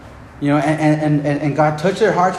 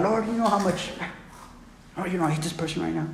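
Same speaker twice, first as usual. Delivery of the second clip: soft, under-the-voice grumble to God; mock candid prayer, hushed; frank confiding prayer; 3 semitones higher, 9 dB quieter than usual.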